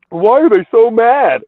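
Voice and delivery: loud, high, drawn-out sarcastic question; mock bafflement. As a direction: wail it slowly in fake innocence. Sarcastically feigning bafflement, then laughing.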